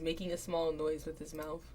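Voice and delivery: ordinary talk, nothing marked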